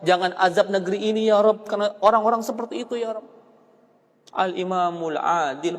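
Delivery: usual speaking voice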